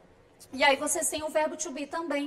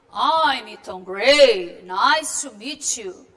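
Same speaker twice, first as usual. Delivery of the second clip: slow, louder, lower man's voice; Tom Gray voice; 4 semitones lower, 8 dB louder, much slower than usual. Acted. Teacher voicing Tom Gray meeting Marianne, slow and low. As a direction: introduce yourself slowly and firmly as the captain.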